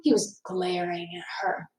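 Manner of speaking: unvoiced hushed narration; breathy hush; whisper. A narrator's reading. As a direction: whisper it low. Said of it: "Ralph whispered".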